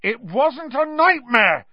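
high, drawn-out indignant yell. A narrator's reading. Ron contradicts his brother before the professor, yelling. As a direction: shout the denial, high and loud. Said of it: "Ron yelled".

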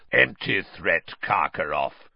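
growl. A narrator's reading. Moody warning Karkaroff from the door, growling. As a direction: rumble the warning. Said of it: "growled a voice".

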